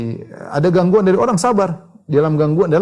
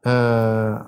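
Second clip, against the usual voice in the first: ordinary talk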